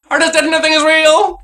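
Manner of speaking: high, loud despairing proclamation; shouted high and loud on the repeat; dramatic yell. Acted. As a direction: cry it out like a tortured artist.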